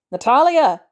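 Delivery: loud calling shout; shouted name, high and loud. A narrator's reading. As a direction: call her name through the door. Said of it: "he shouts".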